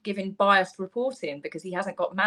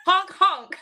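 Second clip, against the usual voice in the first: laughing honk honk, higher; goose honk imitation; 9 semitones higher, 5 dB louder than usual. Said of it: "will you give us a honk honk".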